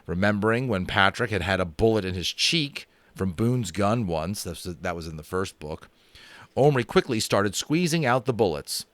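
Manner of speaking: usual speaking voice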